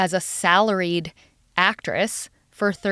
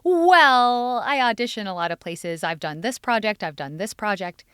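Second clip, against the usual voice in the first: floundering for an answer; 2 semitones higher than usual. Retold or imitated. Actress answering her mother-in-law's question, floundering. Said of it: "didn't really have an answer".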